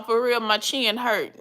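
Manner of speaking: normal talking voice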